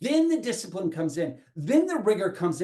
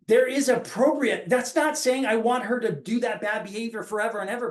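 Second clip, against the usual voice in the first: normal talking voice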